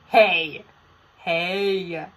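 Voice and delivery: loud sharp protest; loud offended protest; indignant hey. Real, not acted. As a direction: call out the insult, offended. Protests at quiz result.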